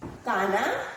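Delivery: ordinary talk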